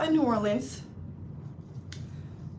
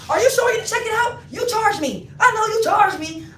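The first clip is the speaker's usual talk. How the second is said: high, loud whiny fake-innocent voice; mock innocent Brianna; 10 semitones higher, 6 dB louder than usual. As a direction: play dumb in a high, pleading whine. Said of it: "acting like she don't know".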